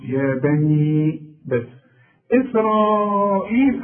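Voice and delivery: يا بني إسرائيل stretched out slowly; slow recitation demo. Shown as a demonstration. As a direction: hold the long vowel in إسرائيل. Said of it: "هنمد أربع حركات". (نطق إسرائيل ببطء مع مد الهمزة)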